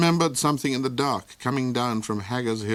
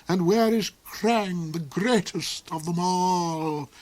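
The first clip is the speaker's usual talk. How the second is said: villain duke voice; 8 semitones higher, slower than usual. A narrator's reading.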